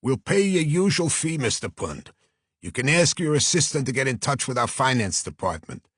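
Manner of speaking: persuasive Brooklyn agent; Brooklyn accent. Acted. Agent offering fee and praising late client, earnest Brooklyn accent. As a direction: make a heartfelt pitch in Brooklyn tones. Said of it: "a voice with a strong Brooklyn accent".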